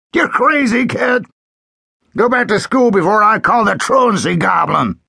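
high, loud scoffing sheriff; mocking shout. A narrator's reading. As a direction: yell at him, amazed and scornful. Sheriff laughing shouts at boy.

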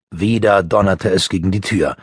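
plain reading voice

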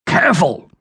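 high-pitched butler's reprimand; emphatic single-word telling-off; stern. A narrator's reading. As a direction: finish the scolding on one high, falling word. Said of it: "the butler said sternly".

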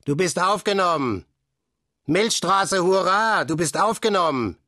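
joyful group call; cheering back. A narrator's reading. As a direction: celebrate the new member. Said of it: "riefen zurück".

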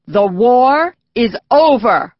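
slow, loud news bulletin; radio announcer. A narrator's reading. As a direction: announce it slowly over the radio. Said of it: "the announcer said".

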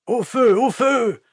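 very high, loud shriek; woman's cry. A narrator's reading. Village woman inciting the crowd, shrieking. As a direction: cry out for his burning. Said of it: "cria une femme".

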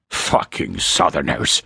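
slow, spat-out curse from a gruff ranger. A narrator's reading. Ranger cursing the Southerners, spitting the words slowly. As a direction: spit the words out in disgust. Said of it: "Corshire spat".

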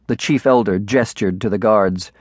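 ordinary delivery

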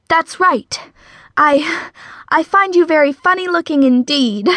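high, bold put-on confidence; trying to sound convincingly amused; forced bravado. A narrator's reading. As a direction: brave it out, pitched well up. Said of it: "she added a slight chuckle at the end that she hoped sounded convincing".

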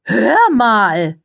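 very high, sugary society voice; gushing press lady. A narrator's reading. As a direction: coo it, all air kisses and fuss. Cordelia voiced in story.

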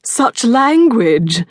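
slow, drawn-out mock shock; mock scandalized. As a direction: act shocked, teasing her. Mock-scandalized brother teasing.

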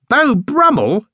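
high, loud disbelieving question; incredulous echo. A narrator's reading. Jonathan doubting Paddington's costume, high incredulous echo. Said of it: "echoed Jonathan".

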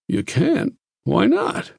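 lightly voiced astonished doctor; surprised Harris. A narrator's reading.

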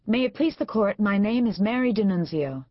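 high, eager and formal; a nervous young lawyer's opening; eager formal address. Acted.

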